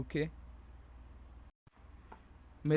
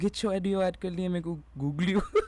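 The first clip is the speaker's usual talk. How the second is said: words breaking into giggles; higher, louder, laughing speech; 6 semitones higher, 5 dB louder than usual. Real, not acted. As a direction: talk through a fit of laughter. Laughing at his own slip.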